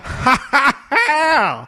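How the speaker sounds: high mock laugh; imitating laugh. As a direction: laugh like a folksy host. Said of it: "he has an accent when he laughs".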